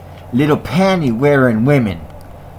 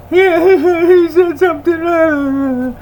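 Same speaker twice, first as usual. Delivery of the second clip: high, squeaky whiny crybaby; mock crybaby voice; 4 dB louder, slower than usual. Mocking the critics who deleted his video in a high whine. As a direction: mimic a whiny crybaby, pitched way up.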